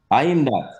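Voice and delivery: I AM THAT rising, then falling away on THAT; statement intonation. Shown as a demonstration. Teacher showing "that is him" in Patois, falling statement intonation. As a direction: state I AM THAT with a dropping end. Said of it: "That is him".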